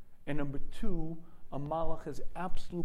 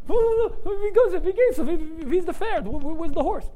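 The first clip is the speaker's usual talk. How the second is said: stammering fright; 13 semitones higher, 8 dB louder than usual. Retold or imitated. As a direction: stumble over words in terror. Shmuel frightened, car starts.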